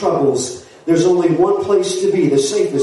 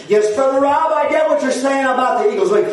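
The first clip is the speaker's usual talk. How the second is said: high, driving revival-preacher challenge; full-force preaching; 8 semitones higher than usual. Real, not acted. Preacher challenging a doubter about the flood, fervent preaching. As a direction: fire the questions at the congregation.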